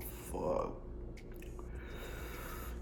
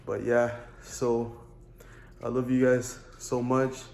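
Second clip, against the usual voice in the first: vlog outro; 5 dB louder than usual. Acted.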